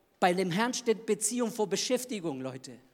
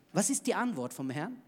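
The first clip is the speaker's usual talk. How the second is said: normal talking voice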